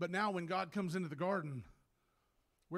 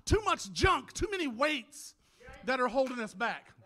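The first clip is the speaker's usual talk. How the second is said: loud, high, fervent pulpit delivery; full-force preaching; 8 semitones higher, 14 dB louder than usual. Real, not acted. Preacher urging church to praise despite burdens, fervent shouting. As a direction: cry out to rouse the congregation to praise.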